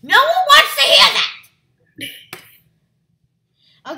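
high, loud laughing protest; laughing exclamation. Real, not acted. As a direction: burst out laughing as you say it. Laughing protest at assistant's line.

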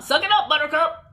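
playful loud jab; teasing shout. Acted. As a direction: tell them to toughen up, loud and playful. Teasing order to the listeners.

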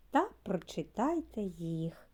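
ordinary talk

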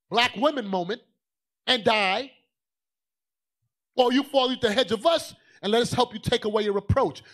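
loud, rising preacher's call; full-force preaching. Acted. Preacher warning the ladies in church, fiery preaching. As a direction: preach it hard at the women. Preacher pressing his point.

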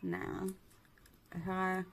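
speaking while fitting veneers; talking around dental tray; fingers in mouth. Real, not acted.

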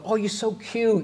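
high-pitched gushing coo; gushing admirer. Retold or imitated. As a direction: imitate someone fussing over a cute youngster. Quotes a woman gushing over boys.

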